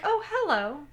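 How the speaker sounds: high-pitched little doll-play voice. Retold or imitated. Girl playing dolls greets Violet, little high voice. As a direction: put on a small, high voice for a doll. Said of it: "she does the little voice".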